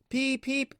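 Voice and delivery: high, bright peep peep like a train whistle; whistle imitation. A narrator's reading. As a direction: toot it out like a little engine's whistle. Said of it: "he whistled".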